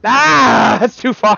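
high, loud yelp of dismay; loud, high outburst; exasperated cry. Real, not acted. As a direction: cry out as the jump fails. Monkey falls in platformer.

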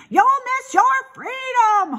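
high, loud honk; piercing goose voice. A narrator's reading. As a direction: honk a warning after the pig. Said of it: "honked the goose".

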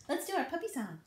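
ordinary talk

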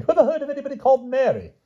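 very high, fast scornful outburst; disgusted. A narrator's reading. Pellinore mocking Grumore's guess, high and scornful. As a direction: scoff at the guess in a high rush. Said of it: "King Pellinore was disgusted".

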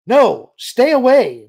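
loud, high and drawn-out warning; urgent, forceful command; sharp warning. A narrator's reading. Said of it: "Leia said sharply".

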